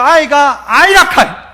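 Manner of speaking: slow, loud Gyeongsang 아이가 아이라카이; God insisting in Gyeongsang dialect. Retold or imitated. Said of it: "하나님은 뭐라고 했어요?".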